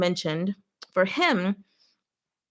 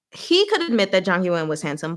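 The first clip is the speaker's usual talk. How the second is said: normal talking voice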